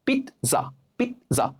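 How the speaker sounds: পিৎজা split in two, "pit... za", high first syllable; correct pronunciation. Shown as a demonstration. Teacher breaking it down for learners, correctly pronounced. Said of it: "এর উচ্চারণ হবে".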